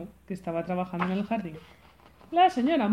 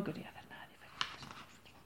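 hushed storyteller aside; whispering; 21 dB quieter, faster than usual. A narrator's reading. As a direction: whisper it like a secret. Narrator confides to child.

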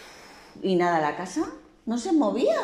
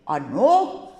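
slow, drawn-out, louder wolf threat; slow menacing wolf threatening the pig; huffing wolf threat; 3 semitones higher, 5 dB louder, much slower than usual. A narrator's reading. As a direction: stretch every soplaré like a menacing wolf. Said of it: "Y el lobo dijo".

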